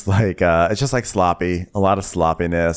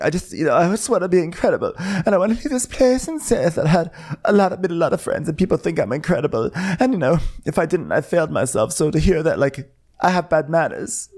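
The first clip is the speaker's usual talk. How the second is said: mock tearful; 9 semitones higher than usual. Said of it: "she's, like, crying".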